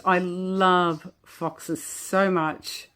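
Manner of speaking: slow, words pushed out through real giggles; trying to talk but cracking up; laughing. Real, not acted. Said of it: "I cannot stop laughing".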